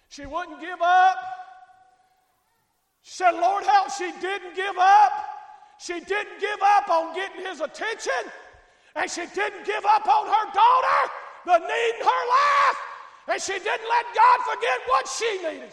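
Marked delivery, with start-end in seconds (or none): 0.1-1.2 she wouldn't give up, said loud. Acted. Preacher to congregation, loudly repeating the line. 3.3-15.7 loud, high preaching cry; preaching at full force. Acted. Preacher rousing the congregation, shouting with full force.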